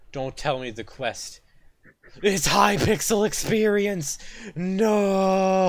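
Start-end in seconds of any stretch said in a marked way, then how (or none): 2.2-5.7 high, loud cry of dismay; despairing shout. Real, not acted. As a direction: wail at the bad news. Gamer realises quest disappointment.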